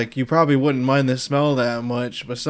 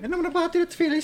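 cartoon voice; 14 semitones higher, 6 dB quieter, faster than usual. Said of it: "That little cartoon".

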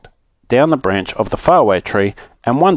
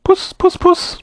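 very high falsetto cat call; slower than usual. Acted. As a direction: coax the cat out in a tiny high voice. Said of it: "he called".

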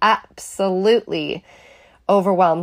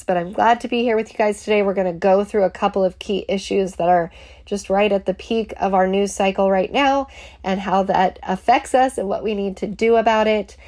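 normal talking voice

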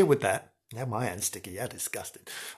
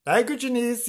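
ordinary talk

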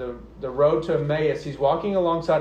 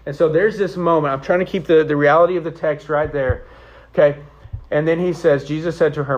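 normal talking voice

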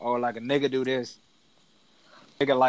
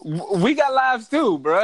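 laughing protest; 8 semitones higher, 5 dB louder than usual. Real, not acted.

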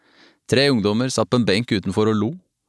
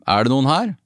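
mild, higher-pitched searching call; 4 semitones higher than usual. A narrator's reading. Guest calls out in deserted reception, mild higher call. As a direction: call to see if anyone answers. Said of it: "ropte jeg".

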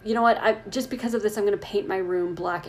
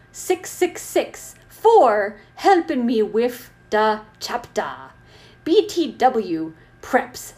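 hammed-up author's note; 2 semitones higher, 4 dB louder, much slower than usual. Reader voices author scolding preps, hammed-up outrage. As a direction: ham it up, erupting at the end.

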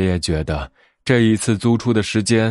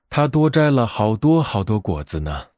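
ordinary delivery, nothing marked

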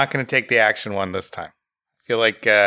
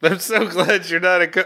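high, gushing parody; hammed-up gladness; mock relief; 6 semitones higher than usual. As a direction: gush in a raised, put-on voice.

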